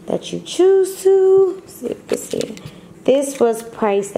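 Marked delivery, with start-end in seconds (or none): 0.1-1.6 playful high drawl. Real, not acted. Showing off her new hoodie. 1.6-2.6 quick whisper to herself; whispered aside. Real, not acted. Checking the camera shot.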